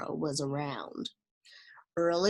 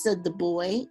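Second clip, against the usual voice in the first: usual speaking voice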